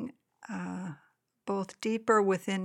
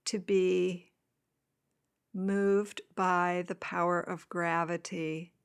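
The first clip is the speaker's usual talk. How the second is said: guided meditation. Acted. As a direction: gently guide them into the body.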